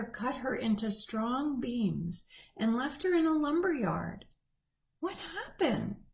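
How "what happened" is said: higher, puzzled little tree voice; a hint of a lost young tree asking; bewildered tree. A narrator's reading. Felled tree wondering aloud, higher and puzzled. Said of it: "the once tall tree wondered".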